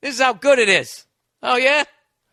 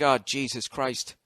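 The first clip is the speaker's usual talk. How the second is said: mock weary groan; 7 semitones lower, 7 dB quieter, slower than usual. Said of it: "and I'm just like".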